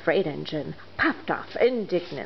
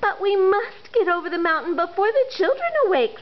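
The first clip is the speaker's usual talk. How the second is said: high, urgent toy voices; 12 semitones higher, faster than usual. Acted. Toys urging the blue engine, urgent. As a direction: press her to go. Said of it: "said all the dolls and toys".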